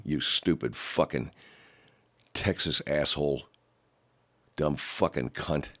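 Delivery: ordinary talk, nothing marked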